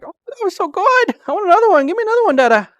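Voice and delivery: very high, loud puppy voice; voicing the dog. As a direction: squeak it out like an excited pup. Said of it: "He's like".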